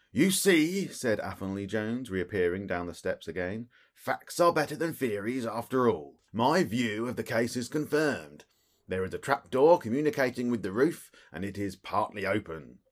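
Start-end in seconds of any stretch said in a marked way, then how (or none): 0.1-0.9 triumphant. A narrator's reading. Jones triumphant, loud. 3.9-12.8 raised, louder self-satisfied official; pompous inspector. A narrator's reading. Jones boasting to Holmes, lightly voiced pompous inspector.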